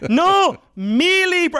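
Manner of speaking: high, slow, insistent "mi libro"; imitated over-excited correction, stretched out high. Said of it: "I was so excited".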